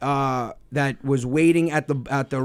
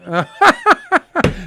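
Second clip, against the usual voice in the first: high, loud burst of laughter; 11 semitones higher, 6 dB louder than usual. Real, not acted. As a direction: crack up loudly. Laugh after punchline.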